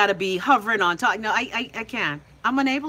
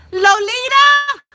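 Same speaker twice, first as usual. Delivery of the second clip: long, drawn-out high call of the name; 14 semitones higher, 9 dB louder, much slower than usual. Real, not acted. Host calling out to a viewer in the chat, long and high. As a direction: stretch the name out loud and high.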